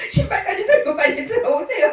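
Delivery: high, hurried-sounding reenacted instruction; retold urgent beckoning. Pastor hiding a frightened mother, acting urgent.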